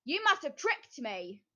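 loud angry witch. A narrator's reading. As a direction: accuse her furiously. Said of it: "stamped her foot angrily".

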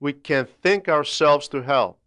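ordinary delivery

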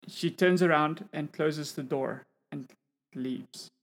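normal talking voice

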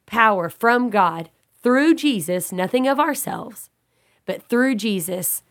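usual speaking voice

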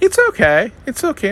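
high, sing-song mock soothing; soothing Colleen. [name] imitating Colleen calming imaginary people, high cooing voice. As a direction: coo it like calming invisible people. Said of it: "she's like".

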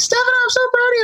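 high, fast mom voice; imitating mom. Son retelling his mom cheering him on the phone, high excited voice. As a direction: squeal it like a proud mother.

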